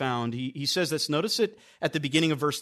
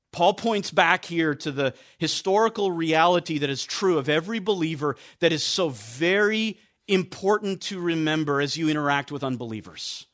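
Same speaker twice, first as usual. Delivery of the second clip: ordinary talk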